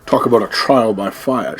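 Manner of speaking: mock action-hero quip. Player quipping after an explosion, mock movie-hero delivery. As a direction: deliver a hammed-up pun, slowly.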